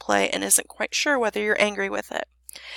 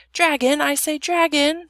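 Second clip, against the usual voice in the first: calling out; 6 semitones higher, 5 dB louder than usual. A narrator's reading. Princess calling out the dragon.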